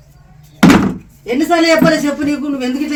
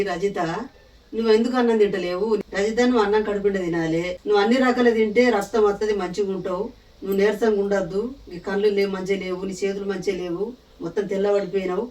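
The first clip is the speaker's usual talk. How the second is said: softer, lower, coaxing the patient; doctor's gentle advice; 5 semitones lower, 6 dB quieter than usual. Retold or imitated. Mother-in-law retelling the doctor advising Rajitha, softer and lower. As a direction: talk her round gently like the doctor did. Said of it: "అని చెప్పింది".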